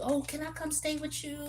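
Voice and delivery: high pleading asking voice; mock pleading friend. Mocking a friend begging to stay, in a high voice. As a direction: ask like a needy friend. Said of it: "Or you let them come stay with you".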